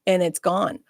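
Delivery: normal talking voice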